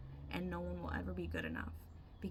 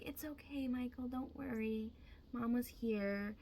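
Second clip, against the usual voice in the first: soothing mother; 4 semitones higher than usual. Retold or imitated. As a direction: coo like a mother petting his head. Mom soothing her son.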